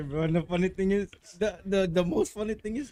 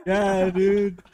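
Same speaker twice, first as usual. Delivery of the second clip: laughing; 9 semitones higher, 6 dB louder than usual. Real, not acted. Laughing through his confession.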